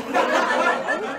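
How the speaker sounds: a hint of the goofy whale; goofy whale insisting it was there; lightly voiced whale. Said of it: "Since you're a whale".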